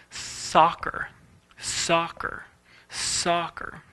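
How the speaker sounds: soccer breathed out softly, one jaw open. Shown as a demonstration. Demonstrating one jaw open to students, softly. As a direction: soccer fading, almost voiceless. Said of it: "only one open close".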